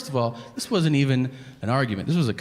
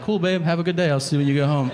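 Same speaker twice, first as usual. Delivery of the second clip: higher, mock-cheerful send-off; breezy goodbye; 4 semitones higher, 3 dB louder, faster than usual. Husband to wife leaving the house, breezily unbothered. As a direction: brush it off with a singsong farewell. Casual goodbye to grim remark.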